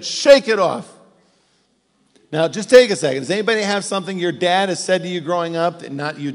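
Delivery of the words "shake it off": louder, higher, curt; bad dad advice. Dad dismissing a hurting kid, curt. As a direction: bark it short and dismissive. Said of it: "Does anybody ever get that?".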